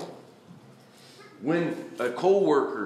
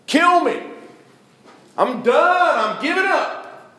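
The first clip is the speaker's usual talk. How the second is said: much higher, louder despairing cry; despairing Jonah; 10 semitones higher, 7 dB louder, slower than usual. Acted. Preacher voices Jonah giving up on God, high anguished cry. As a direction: throw up your hands and beg to die.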